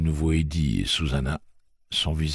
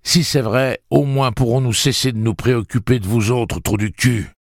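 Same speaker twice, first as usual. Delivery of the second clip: raised, scornful voice; sarcastic retort; 7 semitones higher, 4 dB louder than usual. A narrator's reading. Eddie mocking the townsfolk, scornful and raised. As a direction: sneer it at them.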